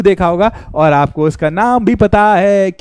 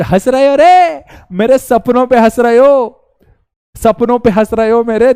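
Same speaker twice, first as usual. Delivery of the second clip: mock wounded outburst; 4 semitones higher, 4 dB louder than usual. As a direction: play the hurt dreamer, overacted. Mock-hurt outburst at laughing students.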